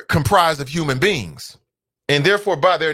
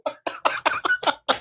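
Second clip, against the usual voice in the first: high squeal of laughter; 5 dB quieter than usual. Real, not acted. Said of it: "Hashtag pissed".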